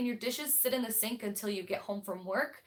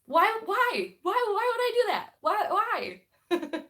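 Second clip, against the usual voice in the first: much higher, louder, hammed-up why why; mock outrage; 11 semitones higher, 9 dB louder, slower than usual. Real, not acted. Vlogger mock-scolding her own slip, high hammed-up outrage. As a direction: scold yourself in a squeaky, overblown way. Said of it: "mock scolding herself".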